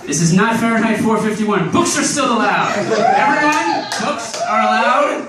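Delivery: loud, high, drawn-out proclamation; mock announcement. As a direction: announce it to the whole room. Mock public announcement, laughing.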